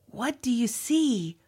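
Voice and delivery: ordinary delivery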